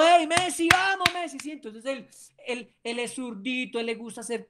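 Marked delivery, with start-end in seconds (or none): 0.0-1.4 high cheer for a player; cheering shout. Shown as a demonstration. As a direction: pump up the left-footer by his nickname. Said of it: "Pero si de pronto".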